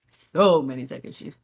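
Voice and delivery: "So many tech issues" sunk low after a laugh; low, weary lament. Real, not acted. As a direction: drop low and stretch "So", lamenting. Repeats lament two contrasting ways.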